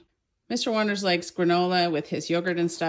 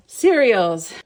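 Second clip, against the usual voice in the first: louder, fast and bright; loud cheerful announcement; 9 dB louder than usual. Real, not acted. Vlogger showing her haul announces the cereals loudly. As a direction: call out the next category like a presenter.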